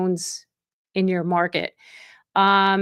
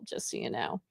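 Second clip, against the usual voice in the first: whispered aside; 10 semitones lower, 14 dB quieter than usual. Real, not acted. Aside after boasting about partner.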